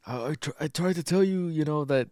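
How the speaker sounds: mock-helpless attempt to explain; imitated pleading explanation. Clarence trying to tell him about the island, pleading.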